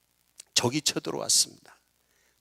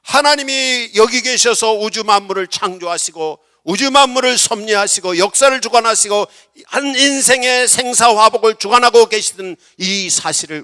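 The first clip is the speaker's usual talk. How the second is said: loud, high, emphatic proclamation; raised-voice sermon delivery; full-force preaching; 8 semitones higher, 13 dB louder than usual. Real, not acted.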